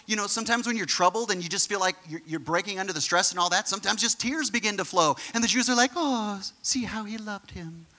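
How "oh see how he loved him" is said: slow, softened imitation of onlookers; drawn-out, sappy "oh"; mock sympathy. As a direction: coo it in mock pity.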